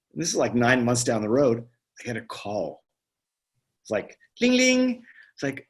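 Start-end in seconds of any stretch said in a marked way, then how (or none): 4.4-5.0 high sung ding ding like a ringing phone; playful mock phone chime; imitated phone ring.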